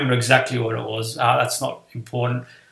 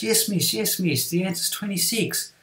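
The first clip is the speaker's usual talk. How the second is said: higher, softer schoolboy imitation; earnest little reply, pitched up; insistent pupil; 6 semitones higher, 5 dB quieter than usual. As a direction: lightly mimic a pupil standing by his answer. Pupil insists under teacher's doubt.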